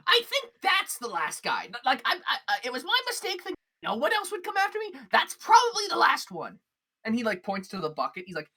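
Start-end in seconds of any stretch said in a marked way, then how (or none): 0.1-6.6 high, loud breezy voice; chatty shopkeeper. Acted. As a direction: rattle it off cheerfully.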